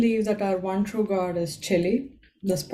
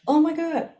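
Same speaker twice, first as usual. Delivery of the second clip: mock astonished, pitched way up; high, stagey shocked "oh my God"; Spider-Man meme gasp; 6 semitones higher than usual. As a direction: imitate two moms stunned at the chili jars. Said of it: "they went like the Spider-Man meme".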